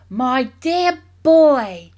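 very slow, loud and high exclamation; ringing, overexcited father; excited cry. A narrator's reading. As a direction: draw each word out grandly. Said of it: "cried Mister Killycranky".

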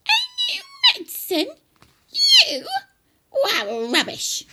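screeching, scornful granny; loud, very high old-woman yell; yelling grandma. Acted. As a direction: shriek it in high-pitched disbelief. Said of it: "she yelled".

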